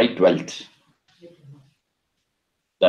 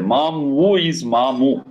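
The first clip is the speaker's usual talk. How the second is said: ordinary delivery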